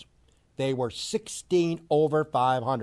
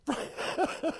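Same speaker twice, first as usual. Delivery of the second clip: breathy laugh; 13 semitones higher, 7 dB quieter than usual. Real, not acted. Laughing at the Pirates.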